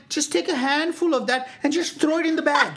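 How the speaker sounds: high, urgent, exasperated begging; exasperated plea. Acted. Customer begging the guy on the phone, exasperated high plea. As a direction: push the plea high and insistent. Pleading with the delivery worker.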